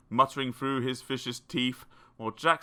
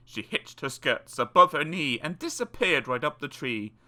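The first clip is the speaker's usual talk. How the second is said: usual speaking voice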